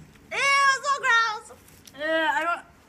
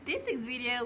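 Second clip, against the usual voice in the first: ordinary delivery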